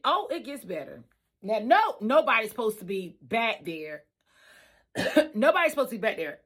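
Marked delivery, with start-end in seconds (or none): none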